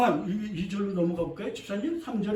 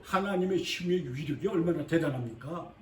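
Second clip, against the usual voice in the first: ordinary delivery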